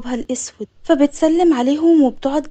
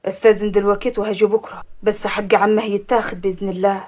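low, controlled man's voice; deep, steady vow; resolved avenger; 6 semitones lower than usual. Acted.

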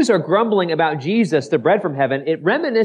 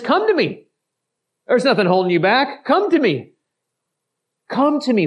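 pleading invitation; 7 semitones higher than usual. Acted. Jesus inviting, high pitch.

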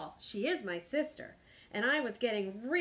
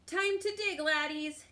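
higher-pitched captain's order; commanding pirate captain; 6 semitones higher than usual. A narrator's reading. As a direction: order the crew to work. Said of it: "commanded Braidbeard".

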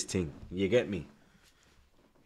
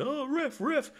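high, whining ref appeal, hammed up; high mocking whine of a footballer complaining; mock whiny moaning; slower than usual. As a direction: mimic a player moaning to the ref. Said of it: "Every minute I see you moaning".

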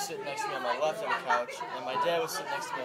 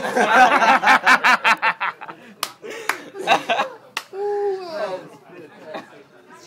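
high helpless howling laughter; doubled over laughing; long loud laughing fit; 12 semitones higher, 18 dB louder than usual. Real, not acted.

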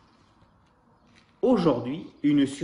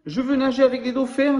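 put-on much higher and louder, thrilled child; excited exclamation; 10 semitones higher, 6 dB louder than usual. Baby koala exclaims at the dolphin show, in a high thrilled voice. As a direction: burst out with a child's longing. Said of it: "s'exclame bébé koala".

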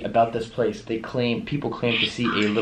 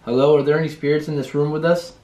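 higher, louder questioning call; 5 semitones higher, 5 dB louder than usual. Real, not acted. Ghost hunter to any spirits in the room, calling out.

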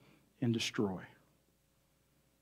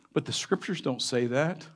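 usual speaking voice

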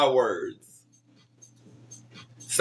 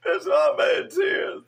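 higher, slow, crying with laughter; tears of laughter in the voice; cry-laughing, real; laughing till crying; 10 semitones higher, slower than usual. Real, not acted. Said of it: "Bitch, I'm crying".

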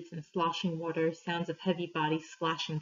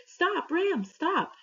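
high-pitched, restrained storybook shout; understated high shout from a narrator; narrated shout; 12 semitones higher, much slower than usual. A narrator's reading. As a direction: call out urgently in a much higher voice, without full volume. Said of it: "Tao shouted".

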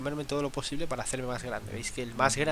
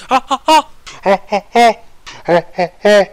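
panicked yelps; 5 semitones higher, 17 dB louder than usual. Real, not acted. Fleeing from players in game.

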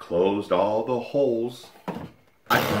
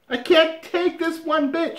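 high, exasperated wife voice; shrill-pitched plea from up the ladder; wife on a ladder demanding the mouse be caught, high and exasperated; wife fed up; 4 dB louder than usual. Acted. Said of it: "she says".

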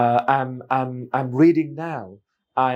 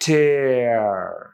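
"Tear" stretched right out, falling; very slow demo; much slower than usual. Shown as a demonstration. Teacher letting learners hear the hidden sound, very slowly. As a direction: draw out the glide in the middle of "tear". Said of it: "টিয়ার ধীরে বললে".

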